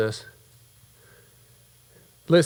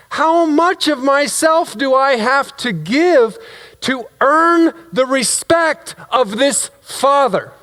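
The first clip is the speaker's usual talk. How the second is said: high, slow, wounded protest; indignant outburst; 11 semitones higher, slower than usual. Acted. Overlooked son challenging his praying father, indignant. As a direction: hammer out each phrase in hurt indignation.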